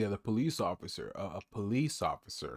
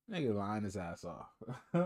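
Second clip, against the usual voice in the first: usual speaking voice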